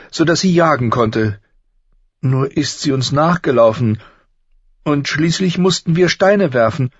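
put-on high, loud girl's voice, pouring out shame; young Arya confessing to her father; ashamed girl. A narrator's reading. (beschämtes Mädchen, hoch und laut)

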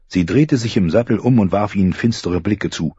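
ordinary talk

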